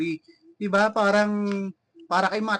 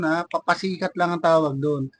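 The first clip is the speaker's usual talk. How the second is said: usual speaking voice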